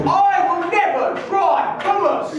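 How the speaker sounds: high, proud vow; defiant declaration. Pretend. Flag owner telling the world in a high, defiant vow. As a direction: declare it like a stubborn patriot. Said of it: "telling the world".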